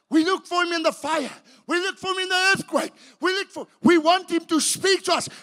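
loud, high, rising preacher's cry; preaching at full force. Acted. Preacher to congregation, full-force preaching. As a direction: hammer each line harder at the congregation.

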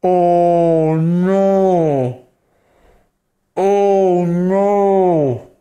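loud, drawn-out despairing oh no; dismayed wail. Real, not acted. Mourning his dead snake to viewers, loud and despairing. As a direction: groan over the loss. Grieving the death.

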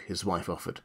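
ordinary delivery

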